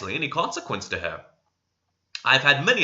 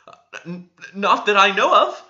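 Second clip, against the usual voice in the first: stammering; 8 semitones higher, 7 dB louder than usual. A narrator's reading. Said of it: "stammered Miss".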